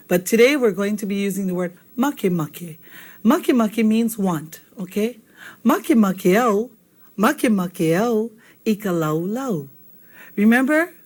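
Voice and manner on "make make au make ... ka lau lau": slow, deliberate Hawaiian phrase; slow teaching model. Shown as a demonstration. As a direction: say it slowly so students can repeat. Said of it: "Let's all say that together".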